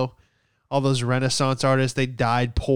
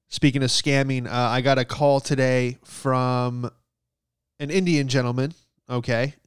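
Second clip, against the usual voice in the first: ordinary delivery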